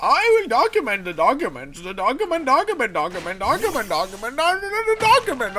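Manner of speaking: high, loud, sing-song support rep droning on; squeaky customer-service parody, spiralling up; mock support agent. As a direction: parody a robotic helpline agent repeating one word. Mocking support agent on the phone.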